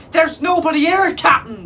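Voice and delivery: high, loud terrified cry. A narrator's reading. Pirates crying to their captain, terrified shout. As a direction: panic toward the captain. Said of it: "they quivered, terrified".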